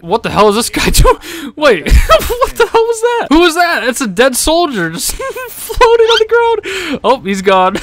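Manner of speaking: high, loud laughing disbelief; cackling what-the-hell surprise. Real, not acted. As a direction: crack up at the weird sight. Startled by a weird game glitch.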